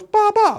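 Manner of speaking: a quick, playful mock sheep bleat. Shown as a demonstration. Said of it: "That probably wasn't a very good impression of a sheep".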